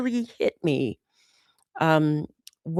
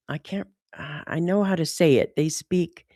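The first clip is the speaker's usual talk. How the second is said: ordinary talk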